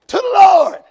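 high, loud preacher's call; loud declaration. Shown as a demonstration. Preacher urging a helper to tell the church, loud call. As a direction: belt it out for the helper to copy. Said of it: "Say it loud".

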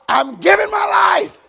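high, projected, drawn-out declaration; loud declaration. Shown as a demonstration. Preacher showing a helper how to say it, loud declaration. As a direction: call it out to the whole church, stretching it. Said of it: "Say it loud".

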